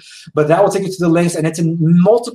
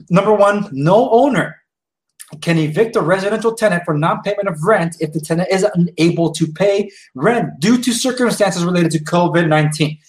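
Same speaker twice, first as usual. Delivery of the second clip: usual speaking voice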